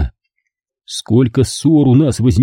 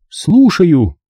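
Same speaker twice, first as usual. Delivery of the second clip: brisk, higher phone voice; phone answer; 6 semitones higher than usual. Acted. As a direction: pick up the call. (мать отвечает на звонок)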